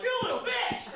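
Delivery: very high squeaky taunt; squeaky insult. Retold or imitated. Beaten comic taunting from the ground, very high squeak. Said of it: "I'm like".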